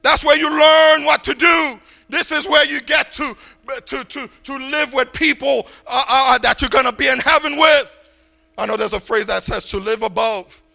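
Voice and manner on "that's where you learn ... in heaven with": loud, rising sermon delivery; preaching. Acted. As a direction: preach it out to the congregation. Preaching to congregation.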